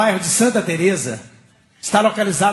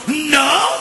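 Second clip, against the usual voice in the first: drawn-out, higher cry of protest; shocked shout; 7 semitones higher than usual. Retold or imitated. Passengers react to his refusal, shocked mock shout. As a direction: cry out as if scandalised.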